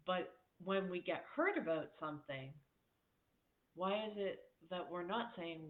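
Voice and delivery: normal talking voice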